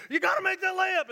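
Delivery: high, loud yell at a player; imitated courtside scream; screaming coach. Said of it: "And the coach is like".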